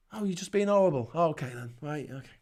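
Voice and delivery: soft, dropping, unimpressed mimicry; quiet mocking brush-off; mock dismissal. Acting out dismissive exchange.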